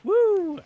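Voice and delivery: high, loud "woo"; cheering whoop. Real, not acted. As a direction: let out a high whoop of welcome. Host cheers the guest's arrival.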